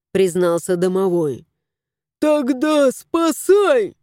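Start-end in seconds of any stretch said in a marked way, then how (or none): 2.2-3.9 slow, insistent high command; demanding. A narrator's reading. Cat demands the house spirit save him. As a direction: demand it, drawn out. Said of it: "потребовал кот".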